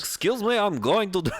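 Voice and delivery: high-pitched attempt at a Russian accent; botched Russian accent, breaking into laughter. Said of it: "get the Russian accent like this".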